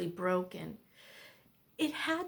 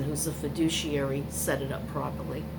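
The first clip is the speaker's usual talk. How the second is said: ordinary delivery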